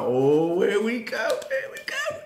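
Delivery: rising singsong here we go; gleeful anticipation building up; excited. Retold or imitated. Fan watching the match, rising excited singsong. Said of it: "I'm like".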